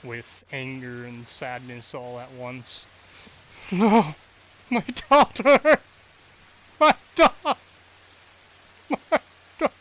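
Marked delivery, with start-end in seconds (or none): 3.7-9.7 high, loud, breaking grief; sobbing cry. Acted. Father crying out for his lost daughter, sobbing. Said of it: "he starts crying".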